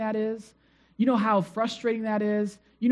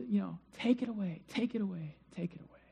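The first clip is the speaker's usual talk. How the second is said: pleading, softer with each repeat until almost gone; fading plea; 12 dB quieter than usual. Retold or imitated. Paul's repeated prayer to the Lord, fading to a hush. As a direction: let the plea die away to nothing. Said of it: "But he says".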